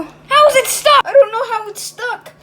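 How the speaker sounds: loud, high, exasperated; panicked yelling. Acted. Arguing over the stuck fridge door, panicked yelling. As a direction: yell it in alarm.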